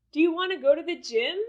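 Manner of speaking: quick question climbing on "gym"; rising intonation. Shown as a demonstration. Teacher repeating a sample question for learners, rising intonation on "gym". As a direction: end "gym" on an upward pitch. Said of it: "the intonation went up".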